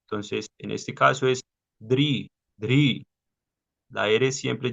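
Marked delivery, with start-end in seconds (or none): 1.8-3.1 dri said as a crisp R followed by an I; Sanskrit dri. Shown as a demonstration. Teacher showing students how the dotted R sounds. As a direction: say dri with an I after the R. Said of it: "como si tuviera una I al lado".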